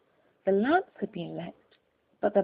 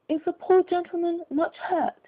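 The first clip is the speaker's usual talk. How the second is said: concerned lady; 9 semitones higher, 3 dB louder than usual. A narrator's reading. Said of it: "she asked".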